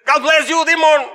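"God bless you, demon" loud and high, Damon said as "demon"; cheerfully butcher the name into "demon"; mispronounced demon. Retold or imitated. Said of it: "[name] batalla con las pronunciaciones". ("demon" pronunciado como demonio, alto y alegre)

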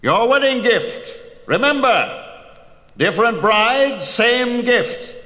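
high, slow, ringing hero's taunt; Perseus. A narrator's reading. Perseus taunting Polydectes before the throne, high ringing mockery. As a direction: deliver the gift like a mocking blow. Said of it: "said Perseus".

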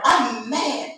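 mock-indignant little boy pout; put-on childish pout; pouty child. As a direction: announce it like a sulking kid, lip pushed out. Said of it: "that push his lip out".

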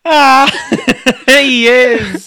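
high, loud, laughing through it. Real, not acted. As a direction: crack up while agreeing. Laughing at Roblox joke.

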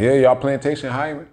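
high eager mock plea, pushed up; begging voice. Mocking himself begging the plantation for work, high and eager. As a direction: play a desperate job seeker begging.

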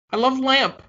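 high-pitched, earnest simpleton; mock-earnest, high film-quote voice; simpleton Brick. Acted simpleton's line from film.

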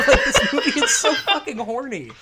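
high, loud, laughing; laughing exclamation. Real, not acted. Host cracking up about the movie, high and laughing. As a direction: blurt it while cracking up.

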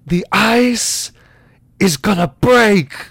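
high, loud, drawn-out outburst; big, slow theatrical line delivery; Christopher Walken. Acted. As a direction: stretch each word into an overwrought dramatic warning. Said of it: "type in Christopher Walken".

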